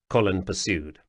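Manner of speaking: usual speaking voice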